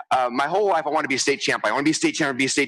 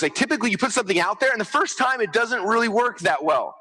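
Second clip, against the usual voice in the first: ordinary delivery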